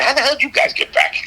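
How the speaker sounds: high, fast, playfully incredulous rock star. Retold or imitated. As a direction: fire it off like a startled star catching kids backstage. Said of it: "he'd look at us and say".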